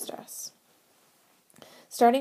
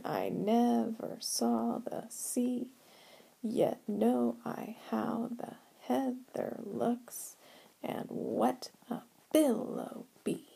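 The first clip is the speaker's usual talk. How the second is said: slow, measured reading with the beat; poem recitation; 4 semitones higher, 4 dB quieter, slower than usual. Acted. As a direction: read each line slowly, letting the iambs fall into place. Reads the poem's lines aloud.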